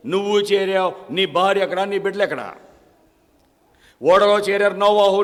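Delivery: loud sermon questioning; preaching. Acted. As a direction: press the listener hard. Preacher challenges listener loudly.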